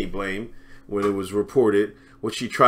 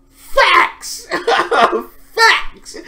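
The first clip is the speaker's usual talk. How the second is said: very high, loud hyped yell of facts; hype crowd shout; 9 dB louder than usual. Pretend. Host shows the audience how to cheer facts, high hype yell. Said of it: "y'all should be like".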